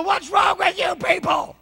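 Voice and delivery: high, loud cry of disbelief at the crowd; exasperated shout. Retold or imitated. Preacher voicing a believer fed up with doubters, exasperated shout.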